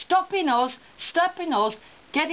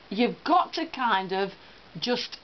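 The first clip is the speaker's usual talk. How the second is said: usual speaking voice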